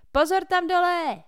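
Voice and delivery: very high, loud roar; roaring shout. A narrator's reading. Tucker Lee roars a warning at those below: full yell. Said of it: "zařval Tucker Lee".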